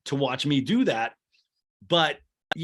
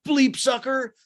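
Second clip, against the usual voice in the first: high, loud imitated insult; hammed-up outburst at another driver; mock road rage; 11 semitones higher, 6 dB louder, slower than usual. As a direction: yell it at a driver cutting in.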